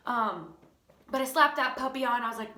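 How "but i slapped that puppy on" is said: normal talking voice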